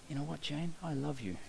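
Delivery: soft, hushed love confession; a boy murmuring love to his girl; tender declaration. Acted. James murmuring to Jane, soft and tender.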